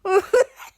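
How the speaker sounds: short, high, loud laugh; sudden high chuckle. Acted. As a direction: burst out in a quick high giggle. Laughs at the disgusted friend.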